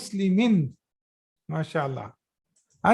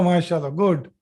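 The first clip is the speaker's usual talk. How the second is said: ordinary talk, nothing marked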